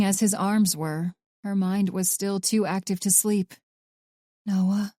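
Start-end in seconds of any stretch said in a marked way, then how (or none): none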